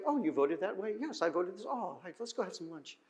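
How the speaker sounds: mock the fake harmony with a sweet hush; sarcastic mock friendliness. Preacher mocking society's harmony, softly sarcastic. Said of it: "We're just like".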